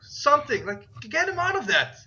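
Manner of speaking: put-on high-pitched pleading kid; high, halting child voice; boy's voice. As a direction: plead urgently as a young boy. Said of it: "the boy's like".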